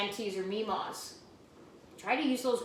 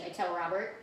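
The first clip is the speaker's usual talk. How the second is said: usual speaking voice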